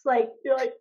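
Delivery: ordinary talk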